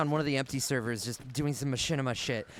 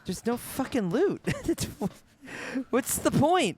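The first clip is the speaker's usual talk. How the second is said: real exasperation, pitched up; frustrated; 10 semitones higher, slower than usual. Real, not acted. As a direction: vent at the pointless empty map. Frustrated at empty map.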